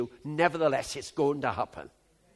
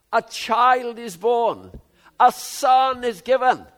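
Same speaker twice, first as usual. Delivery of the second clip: put-on much higher, louder and slow on "a child is born, a son is given"; emphatic proclamation; 8 semitones higher, 12 dB louder, slower than usual. Shown as a demonstration. Preacher proclaiming the right emphasis, slow and loud. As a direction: ring out "child" and "son" slowly. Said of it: "The big emphasis is not unto us".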